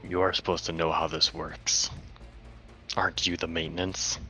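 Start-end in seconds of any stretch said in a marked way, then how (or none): none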